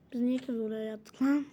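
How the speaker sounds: ordinary talk, nothing marked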